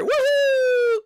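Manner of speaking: very high, loud cartoon whoop; high squeaky woohoo; silly cheer. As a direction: whoop it up as you run off. Cheering away from Jeffy.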